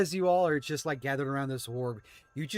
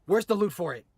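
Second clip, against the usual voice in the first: higher, louder annoyed yell; frustrated outburst; 6 semitones higher, 7 dB louder, faster than usual. Acted. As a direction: curse the missing loot loudly. Rider frustrated about missing loot.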